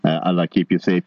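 ordinary delivery

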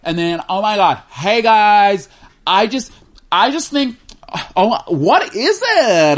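high, drawn-out mock vlogger gush; annoying influencer voice. Co-host parodying an unboxing video, annoying influencer voice. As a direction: overdo a gushing influencer, stretching words up high. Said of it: "Like that annoying voice".

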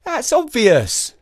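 put-on slow high boy voice; unimpressed little boy. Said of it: "said Jake".

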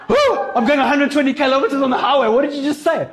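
high, flustered exclamation; mock alarmed driver. Driver on the highway to passing car, high and flustered. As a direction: act out straining to hear at speed.

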